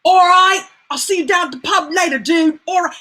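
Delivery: put-on high, loud Brixton London accent; Idris Elba. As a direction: call out like a London mate, British accent. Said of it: "Brixton-y kind of dude".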